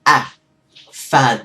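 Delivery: fad punched short and loud; đọc fad thật ngắn; short clipped fad. Shown as a demonstration. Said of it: "nó trở thành cái tiếng rất là ngắn".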